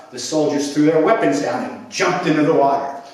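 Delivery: ordinary delivery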